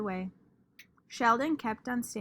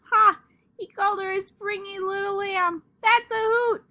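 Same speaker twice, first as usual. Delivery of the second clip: mocking glee; 10 semitones higher, 9 dB louder than usual. Junie B laughing at Sheldon.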